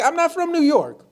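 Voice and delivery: high-pitched retort; mock indignant protest. Panelist denying he is from New York, mock indignant. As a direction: push it up high in imitated offense.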